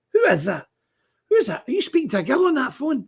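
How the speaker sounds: retold high-pitched questioning; incredulous challenge. Driver interrupting his passenger's phone call, incredulous. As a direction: challenge him, voice jumping up.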